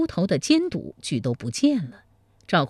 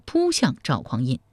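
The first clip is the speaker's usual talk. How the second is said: ordinary delivery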